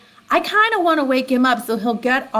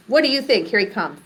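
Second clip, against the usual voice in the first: ordinary talk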